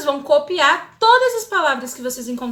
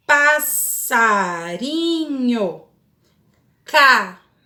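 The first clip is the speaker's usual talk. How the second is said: very slow, drawn-out word by word; slow and calm; much slower than usual. Shown as a demonstration. Teacher modelling calm reading aloud for pupils, slow. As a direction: read each word slowly, clapping syllables. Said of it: "em voz alta, calmamente".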